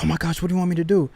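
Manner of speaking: high, rushed mock panic; panicking wife. Fiancee on the phone after his crash, mock panicked. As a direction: blurt it fast like a panicking fiancee. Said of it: "now she's panicking".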